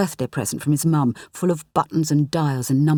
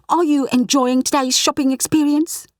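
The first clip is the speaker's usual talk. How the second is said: higher, louder overpolite salesman voice; mock shop assistant; 9 semitones higher, 4 dB louder than usual. Clyde imitating the patter his boss wants, overpolite. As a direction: play the chirpy supermarket clerk.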